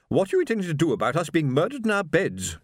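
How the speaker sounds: high-pitched, indignant demand from a haughty lady; demanding wife. A narrator's reading. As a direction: demand an answer as an affronted matron. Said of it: "she demanded".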